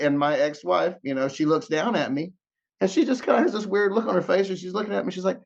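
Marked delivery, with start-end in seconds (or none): none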